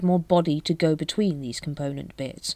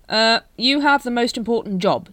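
raised, louder improvised reassurance; hurried; 6 semitones higher, 6 dB louder than usual. A narrator's reading. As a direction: jump in hurriedly, higher and louder. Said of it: "said Vimes hurriedly".

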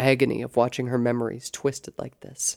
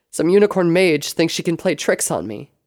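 raised, loud defiance; indignant thought; 5 semitones higher, 8 dB louder than usual. A narrator's reading. Sable scorning the mage behind the illusion, indignant. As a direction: scoff with indignation, pitched up.

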